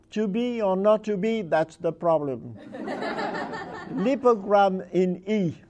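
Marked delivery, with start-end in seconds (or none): 2.7-3.9 real laugh. Real, not acted. Reader cracks up at wordplay.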